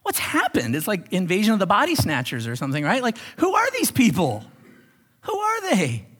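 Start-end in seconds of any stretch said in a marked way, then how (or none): 0.0-0.8 high, disbelieving reaction; acted bewilderment. Congregation reacting to Aaron agreeing, bewildered. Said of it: "And you're like". 3.4-6.1 acted disbelief. Disbelief at Aaron and the people.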